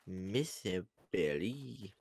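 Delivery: ordinary talk, nothing marked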